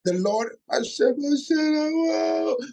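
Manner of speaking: mean every word, slow and heartfelt; said with love. Shown as a demonstration. Mark redoing the psalm as the pastor asks, slow and heartfelt. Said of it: "say it with love and say it like you mean it".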